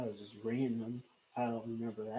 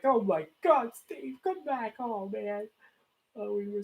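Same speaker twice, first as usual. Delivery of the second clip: high, loud, slow wailing plea; mock tearful plea; 10 semitones higher, 7 dB louder, slower than usual. Fan pleading with Steve to come back home, hammed-up tearful wail. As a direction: ham up a tearful cry for him to return. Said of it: "I was crying".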